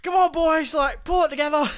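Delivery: high, loud mock Yorkshire army bark; impression of Jason Isaacs as Zhukov. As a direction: belt it like a blunt commander rallying the team. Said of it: "going like".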